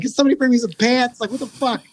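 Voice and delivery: retold desperate call. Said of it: "Are you screaming with shit in your pants?".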